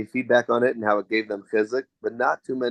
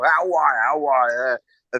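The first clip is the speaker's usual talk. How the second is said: mock New York greeting, raised pitch; joking New York accent; 2 semitones higher, slower than usual. Siblings joking about father, mock New York accent. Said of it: "jokes about it".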